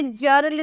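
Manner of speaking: जर्ल said with "j" in place of hard "g"; wrong soft g. Shown as a demonstration. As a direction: start जर्ल like "jar", as a misreading of girl. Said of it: "नहीं पढ़ेंगे".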